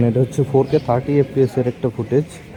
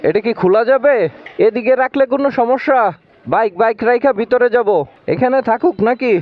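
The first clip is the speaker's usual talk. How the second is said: calling out; 7 semitones higher than usual. Real, not acted. Calling out to relatives at house.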